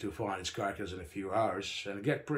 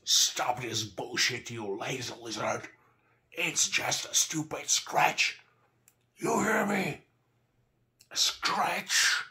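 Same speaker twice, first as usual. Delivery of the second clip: strained yell rising higher, words stretched; 4 semitones higher, 4 dB louder, slower than usual. A narrator's reading. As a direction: yell at a wounded comrade to snap out of it. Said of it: "I shook him and yelled".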